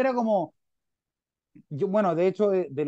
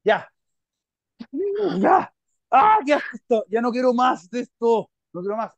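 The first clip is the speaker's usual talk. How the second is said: retold outburst, much higher and louder; fed up; 4 semitones higher, 5 dB louder than usual. Quitting smoker done with the book, exasperated loud outburst. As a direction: burst out, sick of it all. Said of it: "donde tú llegas y dices".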